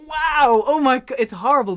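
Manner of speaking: loud, high blurted wow; awed reaction. Acted. Singer acting out a reaction to a favourite lyric, loudly awed. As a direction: react big and loud, stunned by the line.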